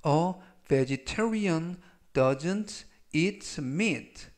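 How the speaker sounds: "A vegetarian doesn't eat meat" read very slowly, word by word; slow model reading. Shown as a demonstration. Teacher reads the example sentence slowly to students. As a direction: spell out each word slowly. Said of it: "전체적 의미는".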